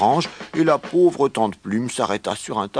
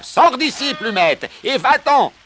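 loud, high-pitched tirade; furious yelling; 12 semitones higher, 5 dB louder than usual. A narrator's reading. Red-faced farm owner throws the hen out, furious yelling. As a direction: bawl them out and order her gone. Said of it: "hurla-t-il".

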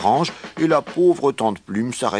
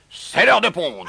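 high, raised rooster command; very loud cackling; 11 semitones higher than usual. A narrator's reading. New rooster orders the hens to lay, loud cackling call. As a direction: call the order out across the yard. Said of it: "cacta-t-il très fort".

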